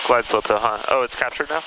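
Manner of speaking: ordinary delivery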